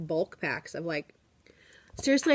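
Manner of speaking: normal talking voice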